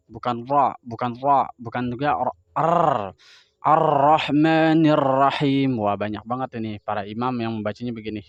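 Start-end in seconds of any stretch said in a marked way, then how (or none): 3.6-5.8 Ar-Rahmanir pelan dan keras, ra ditebalkan; ra dipaksa. Retold or imitated. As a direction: tiru imam yang memaksa ra. (Ar-Rahmanir Rahim dibaca tebal dan dipaksa)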